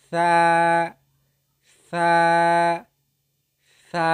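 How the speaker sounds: সা as Arabic tha, tongue tip on upper tooth edges; demonstrated letter sound. Shown as a demonstration. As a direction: hold সা long and level. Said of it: "আগার সঙ্গে লাগে উচ্চারিত হয়".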